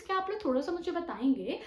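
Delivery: usual speaking voice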